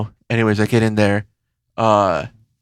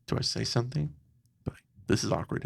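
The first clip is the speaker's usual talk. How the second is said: hushed; 2 semitones lower, 14 dB quieter, faster than usual. Retold or imitated. Hushed inner thoughts.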